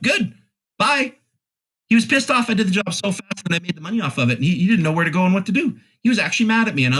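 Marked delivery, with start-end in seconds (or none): 0.0-1.1 high-pitched, curt retold send-off; dismissive. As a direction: brush him off in a raised pitch. Retells brushing off an angry man.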